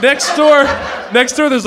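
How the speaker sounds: shouting. Real, not acted. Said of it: "shouting over others".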